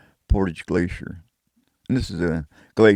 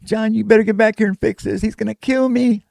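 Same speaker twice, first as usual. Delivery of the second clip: high, louder woman's voice, mildly upset; 4 dB louder than usual. Retold or imitated. Woman on the phone begging him to fix it, high and frantic. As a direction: mimic her frantic phone plea, pitched up. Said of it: "And she's kinda crying".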